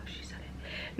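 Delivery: breathy secret whisper; hushed conspiratorial whisper. Real, not acted. Gasped aside to viewers.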